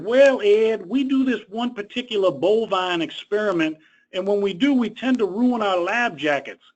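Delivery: mock Texas twang from a caller asking about lab jackets; terrible Texas accent. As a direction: play a Texan researcher with a lightly overdone Texas accent. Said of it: "I'm gonna do my terrible Texas accent".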